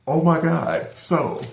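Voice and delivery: loud, high burst of amazement; astonished. Retold or imitated. Vlogger blown away by the successor reveal, loud astonishment.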